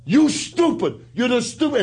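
Sheik insulting Nikolai. Retold or imitated. The Sheik scolding his tag partner, in a lightly voiced accent. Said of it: "On the way to the ring".

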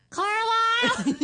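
long, high, loud call into the trees; drawn-out laughing shout of a name. Retold or imitated. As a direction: call the cat's name across the backyard. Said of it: "I'm going like this".

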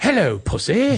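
slow, high mock-British greeting; hammed-up proper British accent; King's English. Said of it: "the king's English".

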